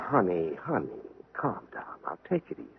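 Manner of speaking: husband calming his upset wife, soft and hushed; soothing hush. Acted. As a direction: gently talk her down.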